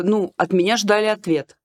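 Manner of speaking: usual speaking voice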